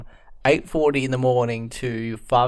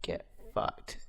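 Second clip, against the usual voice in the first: muttered, low aggressive brush-off; aggressive dismissal; 8 semitones lower, 16 dB quieter than usual. Pretend. Friend snaps back at talk of a week-long birthday, muttered aggression. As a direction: curse them off under your breath. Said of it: "That was so aggressive".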